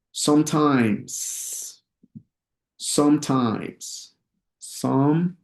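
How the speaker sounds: drawn-out "sometimes", each syllable held; slow model pronunciation. Shown as a demonstration. Teacher models "sometimes" for students to practise, slowly. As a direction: stretch the word out for learners. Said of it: "lo voy a decir lento".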